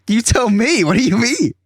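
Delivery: high, incredulous retort; exasperated. Retold or imitated. Confronting the scammer on the phone, high and exasperated. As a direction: throw it back at him, exasperated.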